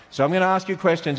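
ordinary talk

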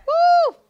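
high, loud preacher's "woo"; sudden high-pitched cry of excitement; exultant whoop. Real, not acted. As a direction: whoop with delight at the scripture. Preacher exclaims over the verse.

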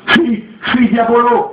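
very high, slow shrieking spirit; hissing owl. A narrator's reading. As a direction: spit it out like a bird. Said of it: "sifflait-elle".

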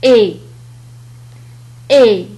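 "إي" said as a clear French E sound; teacher modelling "إي"; French sound demo. Shown as a demonstration. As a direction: model "إي" loud and clean for students. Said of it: "تنطق".

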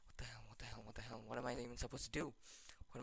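unvoiced frightened whisper; terrified whisper in the dark; scared whisper. Real, not acted. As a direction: breathe the words out in fear. Scared in horror game.

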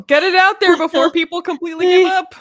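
playing a frantic editor begging; mock urgent editor.

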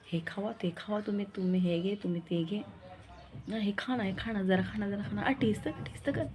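fussing lovingly over her husband, pressing food on him; doting coaxing. Retold or imitated. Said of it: "पण प्रेम तर एवढं येतं ना".

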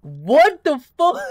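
high, drawn-out baffled question; real bewilderment. Real, not acted. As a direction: stretch it out in rising disbelief. Reacting to something odd in game.

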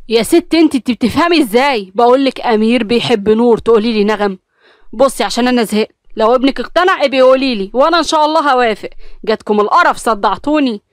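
loud exasperated yell; vent, sick of it all; husband shouting at wife, done with it; fed-up shouting. Acted.

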